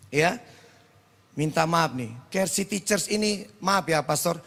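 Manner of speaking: usual speaking voice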